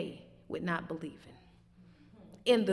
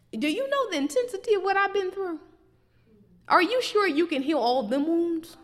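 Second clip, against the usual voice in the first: higher, pleading doubtful voice; doubting believer; 8 semitones higher, 4 dB louder than usual. Retold or imitated. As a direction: plead as if God can't manage it. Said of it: "but then we saying".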